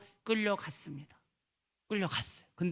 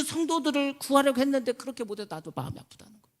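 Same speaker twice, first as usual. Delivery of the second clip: higher, louder and slow, a pained divine plea; lightly voiced God rising in anguish; 7 semitones higher, 6 dB louder than usual. God grieving over lost souls, higher and slow. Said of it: "이런 음성을 들려줬다고".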